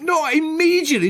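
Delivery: high exasperated Welsh voice; mate corrects Fran in a mock Welsh accent. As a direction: correct her in a squeaky Welsh sing-song. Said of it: "I'm gonna do the accent in a minute".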